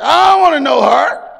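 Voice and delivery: shouted high and loud in mock protest. Preacher yelling his old protest to her brother. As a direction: yell it, playfully indignant.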